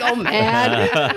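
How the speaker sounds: high, laughing sigh. Retold or imitated. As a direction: laugh through it. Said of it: "I'm just like".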